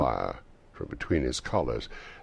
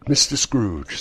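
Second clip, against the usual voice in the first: raised voice announcing a toast; calling a toast; 7 semitones higher, 8 dB louder, slower than usual. A narrator's reading. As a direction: call the table to a toast. Bob calls out a toast.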